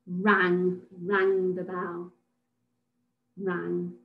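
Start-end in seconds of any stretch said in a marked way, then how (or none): none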